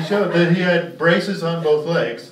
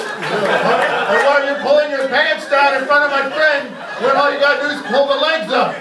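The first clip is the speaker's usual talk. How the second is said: loud, higher furious yelling rant; mock rant; 7 semitones higher, 6 dB louder than usual. Comic voicing Harry yelling at the crippled kid, loud and furious. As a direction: scream the twist at the kid.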